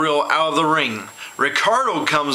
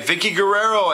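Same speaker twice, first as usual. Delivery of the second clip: normal talking voice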